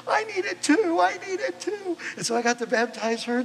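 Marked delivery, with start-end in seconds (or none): 0.1-2.0 much higher and louder, pleading daughter; eager daughter. Retold or imitated. The second daughter begs the pastor, in an excited high plea. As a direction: squeal it like an eager daughter begging. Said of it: "she goes".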